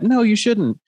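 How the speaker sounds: high, bright angel voice; little cartoon angel. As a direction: squeak it like a tiny cartoon angel. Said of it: "the little angel on one side and the little demon".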